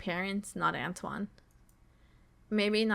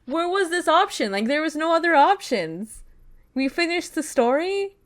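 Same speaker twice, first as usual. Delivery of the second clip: loud, high-pitched questioning; baffled protest; 6 semitones higher, 7 dB louder than usual. Real, not acted. Gamer confused that the story ended with no other options, baffled protest. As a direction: protest in disbelief, voice climbing on the questions.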